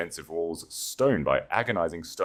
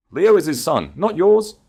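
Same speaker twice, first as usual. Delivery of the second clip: high, loud, final; loud denial; 11 semitones higher, 8 dB louder than usual. A narrator's reading. Eleanor telling the Duke the boy is not his, loud. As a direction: slam the door on him. Eleanor denying the Duke's fatherhood.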